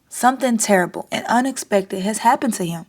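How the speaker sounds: plain reading voice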